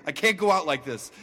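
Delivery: ordinary talk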